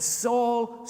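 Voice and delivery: high, loud divine call of the name; loud commanding call; booming call. Acted. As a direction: call out the name from heaven, ringing. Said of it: "he heard this voice speaking to him".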